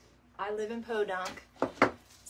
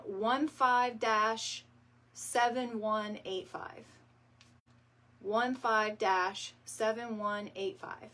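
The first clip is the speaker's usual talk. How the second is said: slow number dictation; 4 dB louder, much slower than usual. Shown as a demonstration. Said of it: "the number is".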